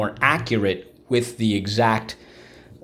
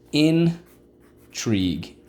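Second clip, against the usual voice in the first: slow stretched; 5 semitones higher, much slower than usual. Shown as a demonstration. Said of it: "Let's go".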